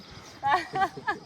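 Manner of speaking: short bright laugh. Real, not acted. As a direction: burst out laughing. Coach laughs after joke.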